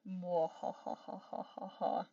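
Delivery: slow evil cackle, mwahahaha; villain's slow evil cackle; mad scientist laugh. Acted.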